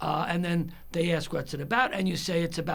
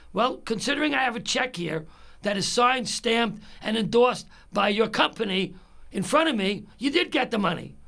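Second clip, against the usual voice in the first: higher-pitched indignant rebuttal; mad; 5 semitones higher, 3 dB louder than usual. Retold or imitated. Caller holding proof that the company was paid, indignant. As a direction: press each point home, voice kept up. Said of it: "why I'm so mad".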